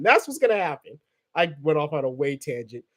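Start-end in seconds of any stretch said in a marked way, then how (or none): none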